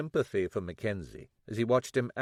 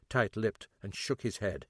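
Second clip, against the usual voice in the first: ordinary delivery, nothing marked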